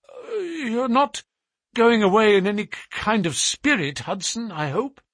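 father timidly appeasing the seaman; timid tameness. A narrator's reading. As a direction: placate him nervously, faltering. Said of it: "said my father with a tameness".

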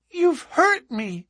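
put-on high little-girl voice, reproachful; a hurt small girl scolding him; reproachful child. A narrator's reading. Little girl reproaches the man who dropped her, high and hurt. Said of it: "said a childish voice reproachfully".

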